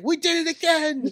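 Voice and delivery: high, slow mock yell; imitating a scream. Retelling how he shook me mid-promo, mock scream. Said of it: "he just started screaming".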